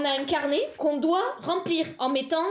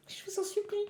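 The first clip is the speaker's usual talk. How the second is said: softer, high, hushed pretend plea; whisper-beg like a desperate kid; mock begging; 6 semitones higher, 9 dB quieter than usual. Streamer begging her teacher watching the live, hushed and high.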